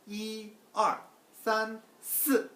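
이, 알, 산, 스 one by one, high and loud; exact pronunciation. Shown as a demonstration. Teacher repeats the count precisely, exact pronunciation. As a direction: separate 이, 알, 산, 스 slowly with firm tones. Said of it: "정확하게 발음하셔야 돼요".

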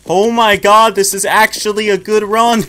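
high, loud thrilled cry; excited shout. Real, not acted. Thrilled at good game run.

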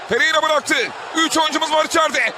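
ordinary delivery

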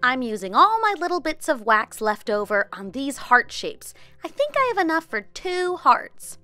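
normal talking voice

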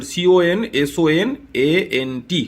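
each letter of "consonant" spelled slowly with clear gaps; slow teacher spelling. Shown as a demonstration. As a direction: dictate the letters slowly to the class. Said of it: "ঠিক আছে, consonant".

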